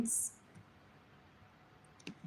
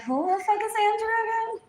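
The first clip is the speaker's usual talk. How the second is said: fast and much higher, incredulous; mock bafflement; 12 semitones higher, much faster than usual. Answering gossiping people about Andrew, high, fast and incredulous. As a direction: toss it off in high quick disbelief. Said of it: "And I'm like".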